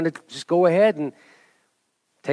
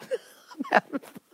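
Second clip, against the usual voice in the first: laughing; 11 semitones higher, 10 dB quieter, slower than usual. Real, not acted. Laughing while filling jar.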